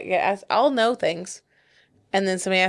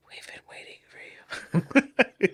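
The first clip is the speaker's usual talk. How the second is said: creepy whisper; 4 dB quieter, much faster than usual. Retold or imitated. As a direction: whisper it like a horror villain. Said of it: "and be like".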